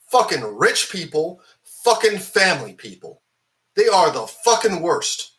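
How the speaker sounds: fed-up viewer railing at the rich family, loud and disgusted; angry rant. Real, not acted. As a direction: spit the words out in disgust. Ranting about rich families.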